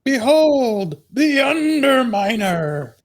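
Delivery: a grand villain, cartoonish and high; Underminer voice. Player shows off his Underminer impression, high and slow. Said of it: "That was a good Underminer".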